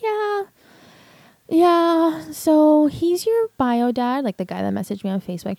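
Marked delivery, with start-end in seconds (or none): 0.0-4.2 high, drawn-out tearful imitation of a confession; imitating tearful crying. Mother admits to daughter who her bio dad is, imitated crying. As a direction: retell it as a slow, high, breaking-down admission. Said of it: "breaking down and crying".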